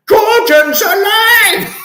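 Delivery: huge high bellow, drawn out; Brian Blessed. Acted. Doing a famous actor for luck, booming roar. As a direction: roar it out slowly at full force. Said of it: "one more for luck from Brian Blessed".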